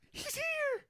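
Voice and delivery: very high, squealed excitement; imitated excitement. Bray as the lights start to flicker, high excited squeal. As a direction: squeal it twice in a high put-on voice. Acting out Bray's thrill at lights.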